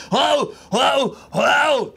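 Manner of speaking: high, loud hollered whoas; imitated trucker's shout. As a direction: holler across a yard like a mad trucker. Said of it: "He'll get out of the truck".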